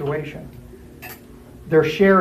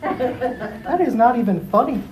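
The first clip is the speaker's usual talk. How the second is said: slow, higher, offended drawl; indignant quote; 7 semitones higher, slower than usual. Acted. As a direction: sound put out and unimpressed. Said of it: "And the eyes are like".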